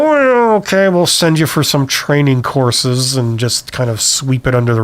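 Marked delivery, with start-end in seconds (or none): 0.0-3.2 mock a boss reluctantly giving in; caving manager. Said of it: "seen some managers cave".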